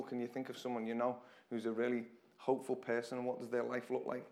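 ordinary talk, nothing marked

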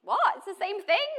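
high, loud what of disbelief; incredulous high-pitched exclamation; shocked disbelief. Retold or imitated. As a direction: squeal it in amazement. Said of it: "And I was like".

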